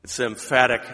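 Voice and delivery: normal talking voice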